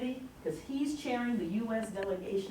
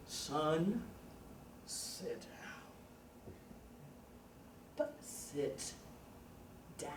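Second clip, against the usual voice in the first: soft whispered warning, much quieter; menacing whisper; 5 semitones lower, 14 dB quieter, much slower than usual. Retold or imitated. Tobias warning Sayre to sit, whispered menace. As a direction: hush it like a mother's final warning. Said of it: "your mother talk to you that".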